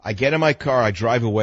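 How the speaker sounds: ordinary talk, nothing marked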